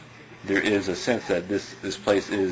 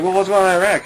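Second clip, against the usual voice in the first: higher, louder challenging question; pointed question; 11 semitones higher, 8 dB louder, faster than usual. Retold or imitated. Challenging the guy about Iraq, pointed and loud. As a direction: press him on it.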